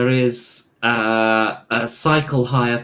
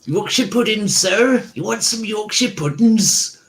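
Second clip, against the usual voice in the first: put-on higher Cornish street seller's accent; 7 semitones higher than usual. GM voicing a Cornish stall seller to players, Cornish accent. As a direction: hawk puddings in a Cornish twang. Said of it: "A Cornish accent selling Yorkshire puddings".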